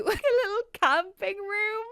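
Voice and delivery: high, loud, laugh breaking through; crying with laughter. Real, not acted. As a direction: squeal it through helpless laughter. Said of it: "I'm crying".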